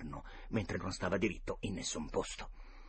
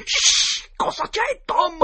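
sharp shush, then a very high, loud cry; hush, then squeal in sudden fright; startled alarm; 13 dB louder, much slower than usual. Acted. Boy startled by his friend at night, alarmed cry.

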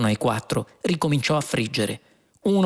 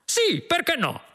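very high-pitched cop's reply; eager agreement. Acted. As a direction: agree readily in a high cry. Other policeman shouts agreement back.

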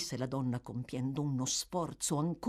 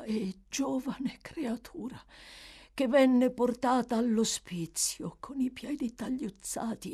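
strained, breathy-edged whispers of a dying woman; a frail dying woman breaking into whispers; dying old woman straining; 6 semitones higher than usual. Acted. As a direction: push each word out like it costs your last strength. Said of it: "quasi stesse compiendo uno sforzo enorme".

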